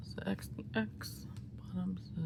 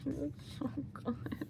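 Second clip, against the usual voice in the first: soft, higher, swooning whimper; 6 semitones higher, 9 dB quieter than usual. Real, not acted. Fan admiring his photo swoons softly. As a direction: melt over the photo.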